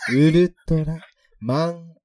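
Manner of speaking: slow motion. Shown as a demonstration. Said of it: "ゆっくり言ってみるやつね".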